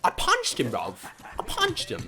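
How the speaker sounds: high, excited squeal in put-on accent; excited boast. Gamer to teammate after a punch, high excited boasting. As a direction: crow it in a high-pitched voice.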